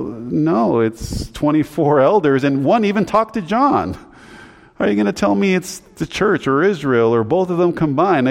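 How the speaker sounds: high-pitched, insistent objection; retold incredulous pushback; emphatic rebuttal. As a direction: argue back in protest. Acted rebuttal to symbolic teachings.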